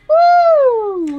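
high, loud cheer of joy; excited whoop. Retold or imitated. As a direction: whoop it up happily. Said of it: "I'm like".

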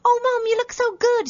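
high, bright mock British accent, imitating her daughter. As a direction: chirp it in a posh British accent like a little girl. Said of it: "She always talks in a British accent".

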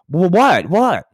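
high, loud startled yelp; panicked innocence. Acted. Kid stopped by the store man after stealing, panicked. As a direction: play caught out, pitch leaping up.

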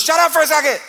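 God shouting at angels. Pretend. God silencing the singing angels with a loud shout. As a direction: cut the angels off loudly.

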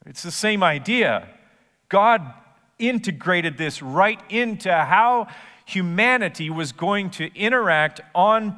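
impassioned pulpit delivery; preaching at full force. Acted. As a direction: press the conviction home.

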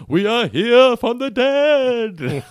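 drawn-out seance ghost voice. Retold or imitated. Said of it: "they're like".

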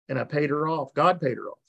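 usual speaking voice

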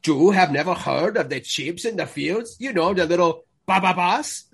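mock Spanish accent. Don Diego amazed she never heard, hammed-up Spanish accent. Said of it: "She's like".

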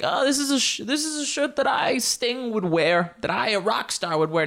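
high-pitched mock rock-star voice; hammed-up star admiring himself, way up high. Host mocks a star praising his shirt, high put-on voice. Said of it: "I don't think [name] is like".

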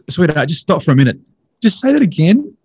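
high, loud, stunned demand; astonished interruption. Retold or imitated. Man nearly off his chair stops the lecturer, astonished. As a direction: stop them in their tracks, pitch leaping up.

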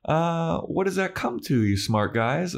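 high, sing-song buttering up; mock sweet-talking flatterer. Podcaster imitating Xander conning frat boys, mock flattering. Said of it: "he starts to do his".

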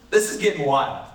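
normal talking voice

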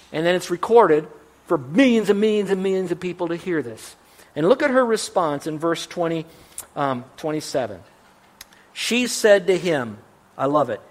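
normal talking voice

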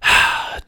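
breathy, weary sigh; exasperated little exhale. A narrator's reading. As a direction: let out an acted sigh. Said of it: "The cricket gave a sigh".

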